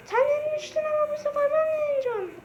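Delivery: squeaky little character boasting about winning; high cartoon kid voice. Character bragging to his rival, squeaky cartoon voice. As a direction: pitch way up into a toy voice, cocky.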